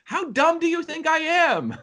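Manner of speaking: high, indignant mock outburst; in a huff. Moviegoer walking out on a condescending film, huffy. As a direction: protest high, like an insulted customer storming out. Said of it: "walking out in a huff".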